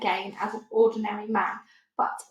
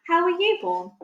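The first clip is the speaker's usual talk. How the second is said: put-on much higher, a small girl correcting gently; little girl Sophie; 9 semitones higher, 5 dB louder than usual. A narrator's reading. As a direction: lift the voice high like a child. Sophie asking.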